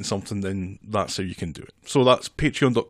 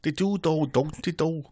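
higher mock Scouse accent; Liverpool accent; 5 semitones higher than usual. Shown as a demonstration. Mum demonstrates a better Scouse accent. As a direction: show how a Liverpudlian really sounds. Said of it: "It's more like that".

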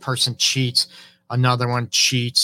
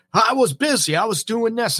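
high whiny excuse, pitched way up; defensive excuse; 9 semitones higher than usual. Retold or imitated. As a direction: whine the excuse back defensively. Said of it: "You get defensive".

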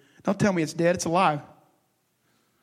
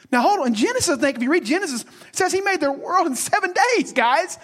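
preaching at full force; 10 semitones higher than usual. Acted. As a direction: drive it home to the church.

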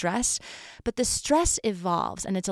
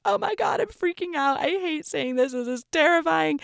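high, mock-panicked quoting of a friend; imitating panic; 7 semitones higher than usual. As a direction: play back her freaked-out voice, pitched up. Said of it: "And she's like".

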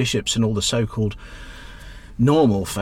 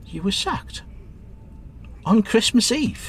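surprised; 8 semitones higher, slower than usual. Acted. Cabbie hears she lost job.

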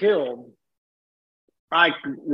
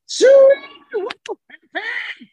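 very high, thin hog call; imitated calling out; 5 dB louder, slower than usual. Uncle trying to rouse the pig, imitated calling out. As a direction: mimic a hollered pig call. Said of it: "he just yells louder and louder".

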